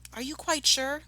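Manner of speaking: high-pitched character question; Missus Fox asking. A narrator's reading. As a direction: lift the voice high for an anxious check. Missus Fox asks her husband.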